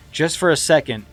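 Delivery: usual speaking voice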